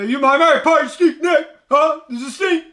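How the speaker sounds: loud, high-pitched impression of a rant; temper tantrum. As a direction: rant at full pitch in a mock fit. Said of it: "throwing a temper tantrum".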